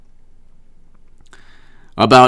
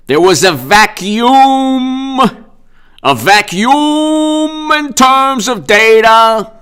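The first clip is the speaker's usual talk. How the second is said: very slow, higher, drawn-out pompous tone; mock pompous governor; 9 semitones higher, much slower than usual. Vlogger mocking the governor's press conference, slow and pompous. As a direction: drag out vacuum like a grand pronouncement. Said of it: "he's like".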